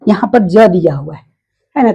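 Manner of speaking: normal talking voice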